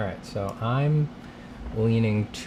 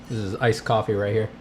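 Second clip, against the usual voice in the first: ordinary talk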